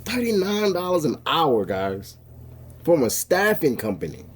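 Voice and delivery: amazed. Real, not acted. Amazed at the pay rate.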